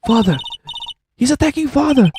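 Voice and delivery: put-on high, panicked child's outcry; alarmed cry. Child seeing father attacked, alarmed cry. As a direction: cry out in horror for your father.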